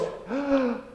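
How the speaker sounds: sharp imitated gasp of shock; quick mock gasp. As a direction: mimic the wife's startled intake of breath. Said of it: "She goes".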